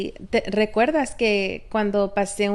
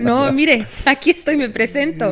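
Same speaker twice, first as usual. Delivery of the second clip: higher, louder put-on patient voice; mock eager patient; 5 semitones higher, 3 dB louder than usual. Wife playing a trusting patient before the doctor, mock eager. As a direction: hand yourself over to the doctor, hammed up.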